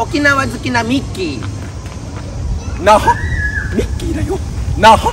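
high squeaky cartoon mouse, shouted; Mickey Mouse voice. Mickey fan of Okinawa shouting Naha in a high squeak. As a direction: play Mickey yelling the name of Naha.

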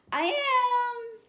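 loud high shout of "I am". Shown as a demonstration. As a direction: shout it out for the kids.

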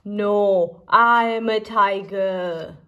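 plain reading voice

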